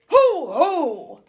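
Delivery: loud hollered hoo hoo; owl hoot. Acted. The owl hollers at the sneeze, loud hooting. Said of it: "who hollered".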